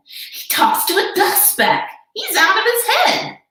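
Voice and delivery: high mocking gossip voice; jungle gossip. Jungle animals gossip about Horton, high mocking voice. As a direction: spread the rumour with a sneer.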